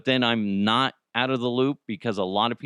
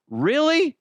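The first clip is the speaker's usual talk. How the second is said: incredulous really; 5 dB louder than usual. Retold or imitated. Calling out the snub.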